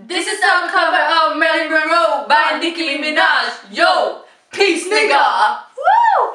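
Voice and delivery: loud excited announcer shout; hyped shout. Real, not acted. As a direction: hype up the intro. Hyped intro shout to camera.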